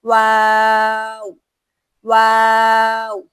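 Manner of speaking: واو held very slowly and loudly, twice; slow, loud call-and-repeat waw; recite along. Shown as a demonstration. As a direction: model the letter for students to repeat. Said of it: "پہلے اس کو میرے ساتھ ادا کریں".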